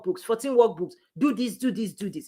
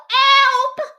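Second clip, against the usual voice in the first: high, loud cry of help; shout for help; 8 dB louder than usual. Retold or imitated. Coach acting a drowning newbie, shouting help. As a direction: call out like someone drowning. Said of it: "You will shout".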